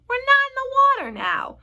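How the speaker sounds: high, loud, incredulous retort; loud scoffing. A narrator's reading. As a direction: scoff at a silly worry. Centipede scoffs at the worried earthworm.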